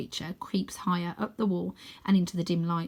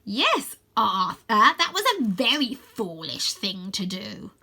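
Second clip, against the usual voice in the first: slow, mocking villain voice; a lightly voiced sneering bird villain; scheming villain Croaky; 3 semitones higher, 3 dB louder, slower than usual. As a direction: savour the scolding.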